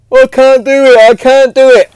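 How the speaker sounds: put-on high, loud whingey child voice; whiny child. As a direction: whine it like a seven-year-old. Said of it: "she's like".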